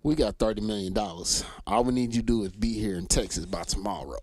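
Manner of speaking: low, quiet mock businessman on the phone; lightly voiced smooth caller with an offer. Friend imitates a caller offering money, low and quiet. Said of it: "was like".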